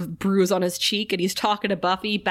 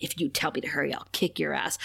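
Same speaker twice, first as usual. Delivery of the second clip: mean threat; 8 semitones lower, 8 dB quieter, faster than usual. Retold or imitated. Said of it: "You don't gotta be so mean to Graham".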